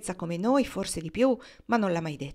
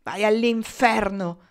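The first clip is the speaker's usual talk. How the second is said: loud, slow, drawn-out curse; angry, raised voice; 2 semitones higher, 8 dB louder, slower than usual. A narrator's reading. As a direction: throw the insult out loudly, stretching it. Angry man curses, then walks off.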